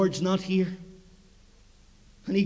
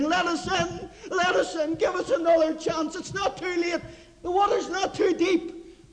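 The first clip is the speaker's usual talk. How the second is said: higher, shouted begging, lightly voiced panic; mock desperate pleading; 8 semitones higher than usual. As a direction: crowd pleading at the shut ark door. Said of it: "battering on the door and saying".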